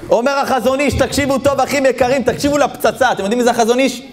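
high, rousing call to attention; preaching at full force. Real, not acted. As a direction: summon the crowd to listen, voice high. Preacher announces a key quote. (דרשה מלהיבה בקול גבוה)

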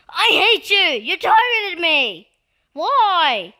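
loud, high outraged wail; mock-mad yelling. Player to attacker who targeted him, mock-mad yelling. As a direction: yell it in hammed-up fury, dragging the words. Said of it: "I'm just getting mad right now".

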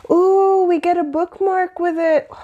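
high, excited ooh of delight; thrilled at the surprise bookmark; a real burst of joy; delighted squeal. Real, not acted. Excited unboxing reveal.

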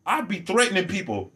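ordinary talk

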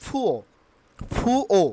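"ফুয়ো" with puffed P, no R; correct pronunciation. Shown as a demonstration. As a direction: say "ফুয়ো" as "phu-o". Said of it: "পুয়ারের উচ্চারণ কী হবে". ("ফুয়ো", শেষে র ছাড়া)

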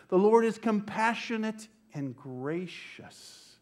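slow, full-voiced scripture proclamation; proclaiming. Real, not acted. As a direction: lift the Lord up for the congregation. Proclaiming the psalm.

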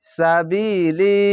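সাবিলি slow with long ী; Quranic recitation. Shown as a demonstration. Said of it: "এক আলিফ টেনে পড়বেন".

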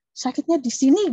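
patient from Medan answering in a loud mock shout. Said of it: "Tapi teriak gitu kan".

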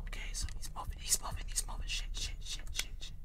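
tense breathless whisper, words barely voiced; scared whisper. Real, not acted. Player hiding from the moving animatronic, panicked whisper. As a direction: hide and hush every word so he won't hear.

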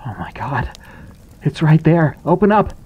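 hushed gasp rising to a loud, high cry; a boy seeing the thing close, hushed then screaming high; terrified exclaim. A narrator's reading. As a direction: panic building to a shriek. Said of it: "Landon exclaimed".

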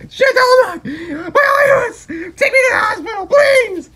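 high, loud crying plea; pain moan. Acted. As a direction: beg through the pain. Begs to go to the hospital.

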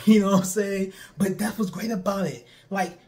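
ordinary talk

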